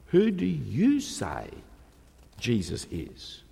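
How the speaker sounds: slow, with "you" held; stress on you. Shown as a demonstration. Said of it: "The emphasis falls on the you".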